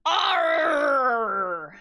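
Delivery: loud, drawn-out "arr"; pirate arr. Shown as a demonstration. Streamer getting into pirate voice, loud arr. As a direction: belt out a long pirate arr. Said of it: "do that before I speak pirate".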